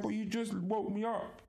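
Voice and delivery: high squeaky disbelief; retelling to a friend, high mock indignation; high mock outrage. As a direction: squeak it in pretend shock. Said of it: "I was like".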